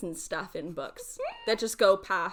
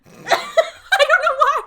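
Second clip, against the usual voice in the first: high, loud, slow words through real laughter; cracking up; 14 dB louder, much slower than usual. Real, not acted. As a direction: laugh helplessly while saying it. Cracking up at the Transylvania line.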